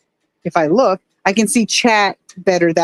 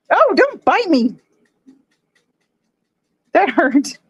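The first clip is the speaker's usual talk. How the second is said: high, slow startled cry as the fish bite; ouch, don't bite me; pained yelp; 6 semitones higher, slower than usual. Real, not acted. Owner yelping at her nibbling fish, high startled cry.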